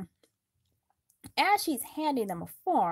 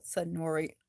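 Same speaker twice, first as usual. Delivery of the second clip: ordinary delivery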